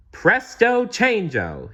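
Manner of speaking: high, slow showy magician call; magic flourish. A narrator's reading. Genie changing his clothes by magic, showy flourish. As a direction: announce the trick with flair.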